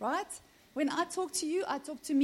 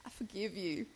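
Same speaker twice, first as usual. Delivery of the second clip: very soft, lower, grudging pardon; mock forgiveness; 4 semitones lower, 13 dB quieter than usual. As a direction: forgive in a hushed, put-on solemn voice. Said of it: "And you're like".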